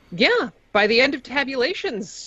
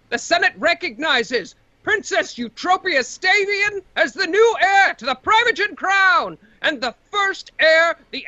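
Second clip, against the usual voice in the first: magically amplified voice; 9 semitones higher than usual. Acted. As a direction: boom it out like an amplified herald. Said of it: "the announcer stands up once again".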